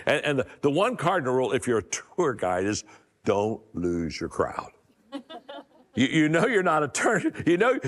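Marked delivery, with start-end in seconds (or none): 3.2-4.7 each word slow and deliberate, pitch dropped low; slow emphatic punchline. Shown as a demonstration. Speaker stressing the tour guide rule to his audience, slow and emphatic. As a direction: land the rule slowly and weightily.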